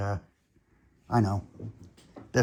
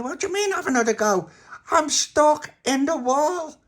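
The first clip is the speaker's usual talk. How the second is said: indignant outburst; 7 dB louder than usual. Acted. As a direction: protest while stuck in wall. Tony outraged at friends.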